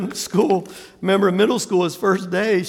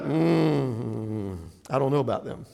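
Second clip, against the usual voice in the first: low, soft, doubtful hum and murmur; imitated skeptical grumble; wary disapproval; 8 semitones lower, 7 dB quieter than usual. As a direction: mutter with suspicion under your breath. Voices wary reaction to accents.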